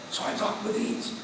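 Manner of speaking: breathy whisper from the false cords; damaged voice demo. Shown as a demonstration. Speaker showing how he talked with false cords, breathy whisper. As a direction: whisper it as if that's all the voice you have. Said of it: "you sound like this instead of like that".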